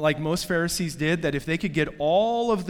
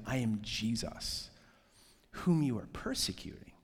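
dropped to a whisper; hushed voice; 6 semitones lower, 9 dB quieter than usual. Retold or imitated. Preacher voices Jesus speaking to Saul, whispered. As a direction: breathe it, barely voiced.

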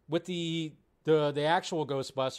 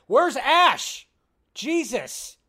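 shocked; 7 semitones higher, 6 dB louder, much slower than usual. Real, not acted. Horrified at gross jacket.

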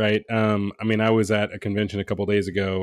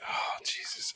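whispering; 15 dB quieter, slower than usual. Real, not acted. Groans at friend's gross noise.

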